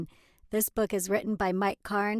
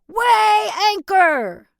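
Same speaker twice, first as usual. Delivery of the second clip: slow loud high pirate order; drawn-out pirate command; 10 semitones higher, 11 dB louder, much slower than usual. Acted. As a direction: bellow it like a captain. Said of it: "Use your best pirate voice".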